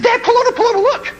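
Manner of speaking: put-on excited kid's squeal; pre-puberty voice. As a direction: squeak it out like a little boy before his voice broke. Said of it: "That was my voice before puberty".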